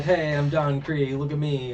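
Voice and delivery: mock boasting. Don Krieg making his entrance, mock boasting. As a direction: announce yourself like a swaggering villain, in mockery.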